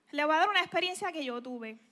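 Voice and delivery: ordinary delivery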